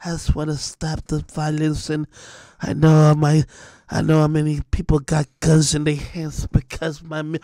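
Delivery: a hint of a soft, mopey rapper voice; mocking soft voice. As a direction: lightly mimic his soft sad talk. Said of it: "in that soft voice on Billboard".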